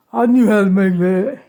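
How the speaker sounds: mock the know-it-all husband; imitating husband. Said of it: "He always tries to say".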